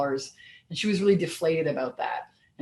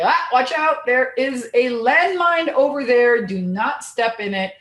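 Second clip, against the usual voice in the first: high, louder urgent warning from a mentor; mentor's warning; 6 semitones higher, 6 dB louder than usual. Pretend. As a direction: call out a danger to a friend, slowing on the landmine. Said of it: "a mentor who can say".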